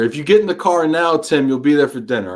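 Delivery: usual speaking voice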